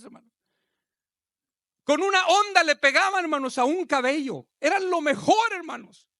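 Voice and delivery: loud, high-pitched fervent preaching; preaching at full force. Acted. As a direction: preach it out, building to a roar. (predicando a todo pulmón)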